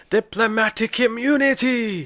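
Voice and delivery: high, slow, shouted villain line; Lethal Weapon villain. Retold or imitated. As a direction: bellow it like a movie villain. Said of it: "Do you not remember?".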